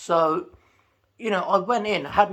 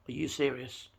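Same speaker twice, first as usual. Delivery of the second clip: cold quiet retort; 3 semitones lower, 8 dB quieter than usual. Retold or imitated. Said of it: "I stared him down".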